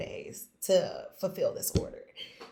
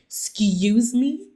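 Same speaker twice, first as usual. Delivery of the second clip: louder, slow, disbelieving Excuse me?; stunned disbelief; 4 semitones higher, 8 dB louder, much slower than usual. Retold or imitated. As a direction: replay the shock of hearing Netflix. Hearing the client is Netflix.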